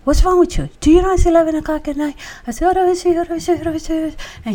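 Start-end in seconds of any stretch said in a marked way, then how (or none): 0.0-2.2 annoyed husband. Retold or imitated. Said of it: "He says". 2.7-4.1 speaking in tongues. Retold or imitated. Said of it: "I just started talking in tongues".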